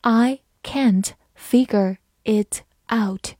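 slow, careful word-by-word read. Shown as a demonstration. Teacher reading slowly for students. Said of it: "慢慢来读".

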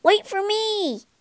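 high eager cry; calling out. A narrator's reading. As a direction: call after them, eager. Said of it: "he cried".

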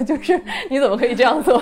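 laughing protest. Real, not acted. Exasperated laughing protest at ending.

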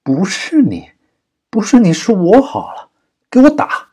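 high, loud mocking jeer, building to a yelled order; cold sneer. A narrator's reading. Kidnapper mocking her denial and ordering a beating, sneering loudly. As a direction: sneer it back, climbing to a shout. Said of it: "王二冷笑道".

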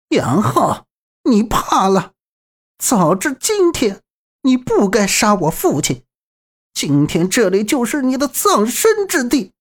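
high, seething villainess voice; through gritted teeth. A narrator's reading. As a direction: spit each word through clenched teeth. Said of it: "咬牙切齿道".

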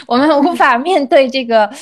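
ordinary talk, nothing marked

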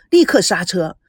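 plain reading voice

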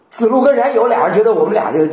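ordinary delivery

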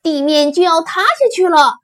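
high, loud warning; alarmed shout. Acted. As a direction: cry out like a panicked herd. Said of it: "他们齐声喊道". (惊慌地大声喊)

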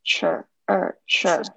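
"Sure" said slowly with a ch-like onset; teacher showing the ch sound in "Sure". Shown as a demonstration. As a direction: start "Sure" with a ch sound, drawn out. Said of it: "它是一个ch的音".

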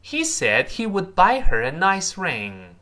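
clear, carefully drawn-out example sentence; teacher's demonstration sentence; model reading. Shown as a demonstration. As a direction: read it out for learners to copy. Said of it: "他这么说".

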